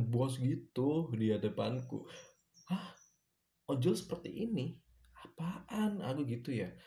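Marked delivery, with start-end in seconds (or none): 2.7-6.0 much higher, incredulous; indignant disbelief. Retold or imitated. Waiter reacting to a bossy driver, incredulous scoff.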